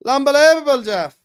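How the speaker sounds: Unbelievable shouted high and loud; loud TV pundit catchphrase, Unbelievable, Jeff. Shown as a demonstration.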